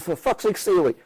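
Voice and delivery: high, exasperated order; urgent warning. Retold or imitated. Manager keeping players out of a scuffle, high and urgent.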